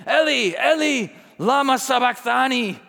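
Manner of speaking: high, loud anguished cry from the cross. Acted. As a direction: cry out to God at full voice. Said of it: "cried out with a loud voice".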